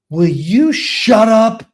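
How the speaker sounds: high, drawn-out exasperated outburst; retold yell back. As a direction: re-enact snapping back at the coach, slowly. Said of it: "one time I yelled".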